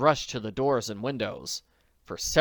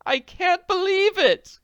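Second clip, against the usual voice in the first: very high, loud, slow frantic cry; 9 dB louder, slower than usual. Acted. Potter can't believe the theft, frantic. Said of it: "cried frantically".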